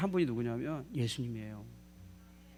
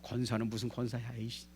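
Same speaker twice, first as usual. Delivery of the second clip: low, subdued grumble, trailing off; muttering to oneself; 4 semitones lower, 7 dB quieter than usual. Pretend. Pastor privately grumbles about a deaconess, low muttering. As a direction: keep the contempt under your breath. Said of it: "속으로".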